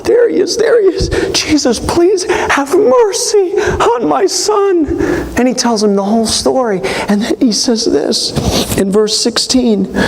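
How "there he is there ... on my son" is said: imitating a frantic father's cry; desperate father. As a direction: beg through tears for your son. Desperate father begging Jesus.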